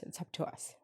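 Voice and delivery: whispered close. Real, not acted. Closing her point softly.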